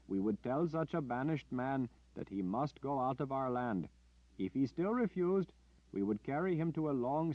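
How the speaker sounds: high, earnest pleading voice; older villager. Acted. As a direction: explain patiently, a little anxious. Older villager explains banishment.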